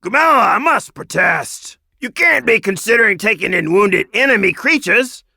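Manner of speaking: high, loud indignant old badger; grumpy protest. A narrator's reading. Grumpy badger protesting to Gamella, indignant. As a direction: object with bristling indignation.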